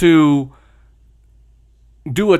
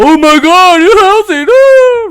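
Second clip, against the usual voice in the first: shrieked much higher and louder, hammed-up panic; mock horrified shriek; 8 dB louder, slower than usual. Right-wingers hearing "housing", mock horrified shriek. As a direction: squeal in fake terror like a horrified right-winger. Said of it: "Right-wingers and some centrists".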